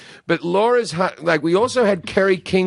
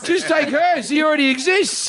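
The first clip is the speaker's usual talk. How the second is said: insistent urging; 10 semitones higher than usual. Real, not acted. Mock urging over the husband.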